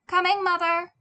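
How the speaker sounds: loud, high call down the hall; loud call to mother. Acted. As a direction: shout it back from another room. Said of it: "he called".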